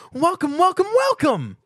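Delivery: very high, loud bubbly pony voice; Pinkie Pie. A narrator's reading. Pinkie Pie greeting Stuart with a loud high squeal. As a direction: squeal the welcome like an overexcited pony.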